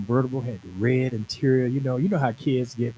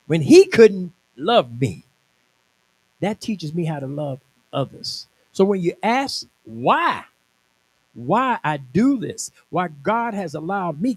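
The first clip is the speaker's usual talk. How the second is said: preaching at full force; 3 semitones higher, 4 dB louder than usual. Acted. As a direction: drive each line home to the congregation.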